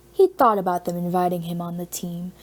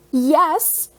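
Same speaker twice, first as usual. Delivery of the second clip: a quick, higher, eager yes; eager blurt; 8 semitones higher, 5 dB louder than usual. A narrator's reading. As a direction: jump in before she finishes. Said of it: "Peter blurted out".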